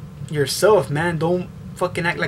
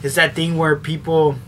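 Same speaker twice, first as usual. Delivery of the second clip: normal talking voice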